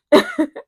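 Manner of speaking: high burst of laughter; loud laugh. Real, not acted. Laughs at own joke.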